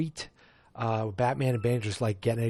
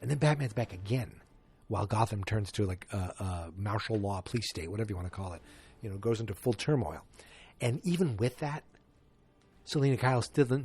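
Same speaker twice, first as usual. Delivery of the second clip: normal talking voice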